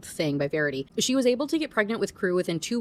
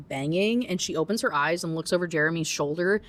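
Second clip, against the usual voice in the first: ordinary talk, nothing marked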